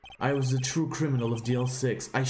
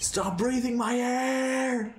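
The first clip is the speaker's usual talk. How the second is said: much higher, very slow unhinged shriek; crazed scream; 10 semitones higher, much slower than usual. Acted. Airless man lunging at the father, crazed scream. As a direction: drag it out like a madman.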